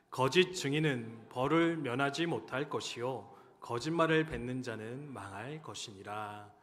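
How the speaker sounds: loud, slow solemn recitation of scripture; loud, slow unison Bible reading. Acted. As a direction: read it out to the congregation, slow and weighty. Congregation reads scripture together.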